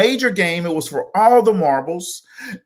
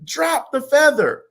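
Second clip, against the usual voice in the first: much higher, eager mock crowd chant; excited crowd; 9 semitones higher than usual. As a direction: egg the feather on like a giddy crowd. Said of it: "the people were excited and, and said".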